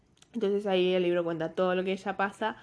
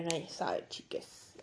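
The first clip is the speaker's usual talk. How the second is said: whispered aside; 3 semitones lower, 10 dB quieter, slower than usual. Real, not acted. Aside to viewers, trailing off.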